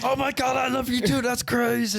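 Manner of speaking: very high, gushing pet voice; excited dog. Pretend. Dog answering owner's I love you, excited high voice. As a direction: squeal it as an adoring dog. Voicing the dog's delighted reply.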